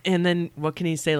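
normal talking voice

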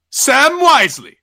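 loud, high, stretched-out incredulous cry; outraged disbelief. Acted. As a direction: blow up at the name, dragging it out. Lucifer repeats name, escalating.

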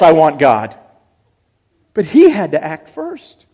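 loud, driving sermon voice, rising; fervent preaching. Acted. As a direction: cry it out to the congregation. Preaches at full force.

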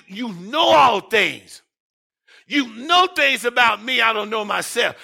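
a pastor voicing Peter's plea, loudly preached; preaching. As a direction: lean hard into each line.

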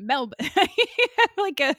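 laughing through Melbourne; giggling Melbourne. Shown as a demonstration. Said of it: "as they say in Australia".

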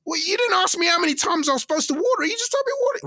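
high, quick, whiny excuse; defensive friend voice. Pretend. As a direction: talk back as the guilty friend.

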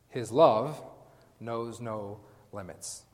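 slow, weighty stress on "His love knows no limits"; slow emphasis. Shown as a demonstration. Preaching to the congregation about God's love, slow and weighty. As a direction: lean into "knows no limits" slowly. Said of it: "preacher drives the point home".

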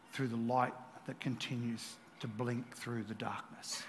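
low, soft, paused preaching; hushed guidance. Acted. Preacher guiding listeners through darkness by faith, hushed. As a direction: ease through it quietly, step by step. Quiet picture of blinking light guiding.

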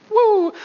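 high, held "woo" like a police siren; playful high-pitched siren noise; imitated siren whoop. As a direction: mimic a short siren burst. Imitates police siren when pulled over.

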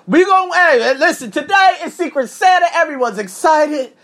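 high, bright-eyed teacher announcing Secret Santa. Retold or imitated. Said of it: "She said".

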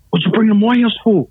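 high-pitched, indignant retold challenge; mock indignant. Host objecting to a pastor at his house, mock indignant.

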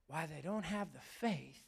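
ordinary delivery

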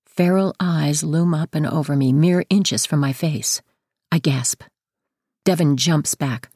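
usual speaking voice

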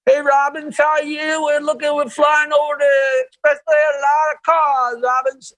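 high, sustained monotone call through a megaphone; megaphone helicopter reporter. Retold or imitated. Helicopter reporter to Robin over the expressway, megaphone voice. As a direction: imitate a traffic reporter hollering from a helicopter. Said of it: "and he used a megaphone".

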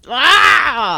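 strangled slow cry of frustration; frustrated outburst. Real, not acted. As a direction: burst out slowly, bottled up. Frustrated outburst about movie.

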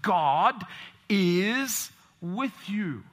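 loud, slow, drawn-out proclamation; proclaimed. Real, not acted. Preacher booming the central truth to his congregation, slow and loud. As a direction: ring out each word to the whole church.

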